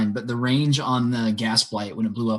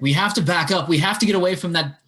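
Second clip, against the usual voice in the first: raised, higher-pitched warning; acted urgent yell; 7 semitones higher, 3 dB louder than usual. Player's character warns everybody about the gas blight, yelling. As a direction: urge everyone back, half yelling. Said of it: "he's gonna yell to everybody".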